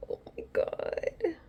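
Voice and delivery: slow hushed whisper in disbelief; awed whisper. Real, not acted. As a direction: breathe it out in amazement. Amazed at own result.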